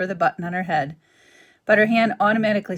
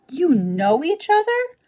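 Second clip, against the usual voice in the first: high, slow, loud surprise; surprised child's question; 10 semitones higher, 7 dB louder than usual. A narrator's reading. Gosling surprised.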